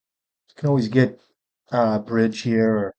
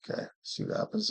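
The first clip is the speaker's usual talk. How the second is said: breathy hushed murmur to himself; whispered aside; 10 semitones higher, 12 dB quieter, faster than usual. Real, not acted. Streamer waiting on the opponent, whispering. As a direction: whisper under his breath while waiting.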